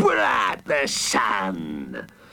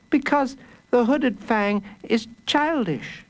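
put-on high-pitched boy's exclamation; high, insistent child voice; child crying out; 11 semitones higher than usual. A narrator's reading. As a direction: cry it out in a small boy's high voice. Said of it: "cried Jacob Tutu twice".

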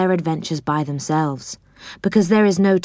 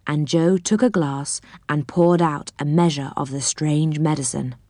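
ordinary delivery

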